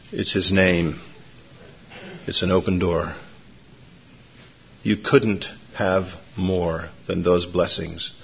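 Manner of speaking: soft, low, measured preaching; tender reassurance. Real, not acted. Preacher softly applies promise to congregation.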